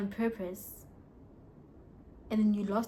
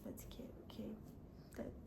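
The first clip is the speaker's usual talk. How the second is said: whisper; 19 dB quieter, faster than usual. Real, not acted. Resigned aside.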